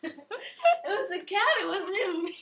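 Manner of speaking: laughing. Real, not acted. Laughing denial after the incident.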